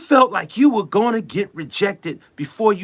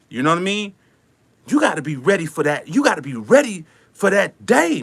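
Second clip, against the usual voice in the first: usual speaking voice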